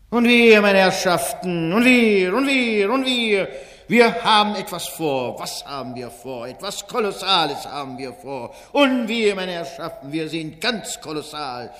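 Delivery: Wenzel Wondrak, fairground barker. Acted. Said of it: "steht der Ausrufer Wenzel Wondrak".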